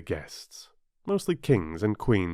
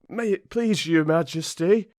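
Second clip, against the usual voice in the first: higher-pitched humble subject; 8 semitones higher, 4 dB louder than usual. A narrator's reading. Gardener Two kneeling to the Queen, very humble. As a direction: address royalty humbly, pitched up. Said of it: "in a very humble tone".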